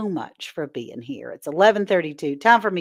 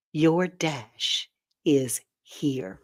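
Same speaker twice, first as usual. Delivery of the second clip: slow breathy whisper to close the poem; 4 semitones lower, 4 dB quieter, much slower than usual. Acted. Ending the poem for viewers, slow whisper. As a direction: let the last line fall to a slow whisper.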